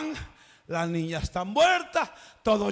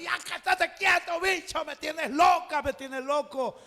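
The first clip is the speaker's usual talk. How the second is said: exasperated parent; 6 semitones higher than usual. Acted. Parent scolding noisy kids.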